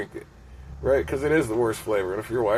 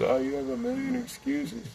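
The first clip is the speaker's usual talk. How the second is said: very high, softer put-on voice; mocking falsetto; 5 dB quieter than usual. Man mimicking people who call out his excuses, mocking falsetto. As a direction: mimic a critic in a squeaky high voice.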